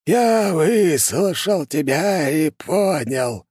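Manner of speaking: put-on high, slow, creepy old sorcerer; eerie voice. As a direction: drag each word out like a ghoulish old man. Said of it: "жутким голосом заговорил старик".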